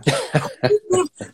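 high mock giggle of a friend; imitated high laugh. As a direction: laugh like a friend caught off guard. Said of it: "y se harta de reír". (risa imitada muy aguda)

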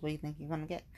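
ordinary delivery

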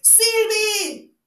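loud, high call drawn out across the garden; high, ringing shout to someone outside. A narrator's reading. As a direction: call out the name at full voice. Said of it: "gritó al exterior".

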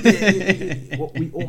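said through laughter, high and loud; laughing. Real, not acted. Joking with co-host about stopping a friend, laughing. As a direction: laugh your way through the admission.

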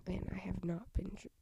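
usual speaking voice

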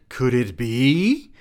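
high, slow sarcastic question; mocking the line. Shown as a demonstration. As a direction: drag out "Could it be?" like a cheesy soap cliffhanger. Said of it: "that's how the paragraph ends".

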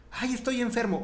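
high-pitched pretend complaint; mock lament. Believer complains of being sick, mock high lament. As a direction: moan it in jest, pitched well up.

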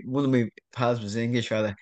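usual speaking voice

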